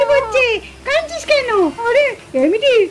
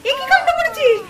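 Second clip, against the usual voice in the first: higher-pitched, indignant reproach; shocked scolding; 4 semitones higher than usual. Acted. Mother catching child soaking the baby, shocked scolding. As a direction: scold in alarm at the mischief.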